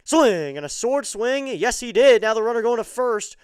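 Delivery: loud, high, excited call. Real, not acted. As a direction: shout the strikeout call.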